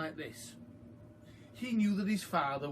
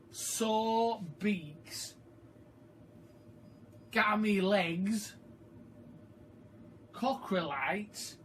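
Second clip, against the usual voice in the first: label read out; 4 semitones higher, slower than usual. A narrator's reading. Reader reading the chicken medicine label, slow and grand.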